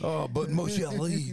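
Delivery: slow, pleading French lover; drawn-out mock French wooing; bad French accent. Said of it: "your French accent is Southern".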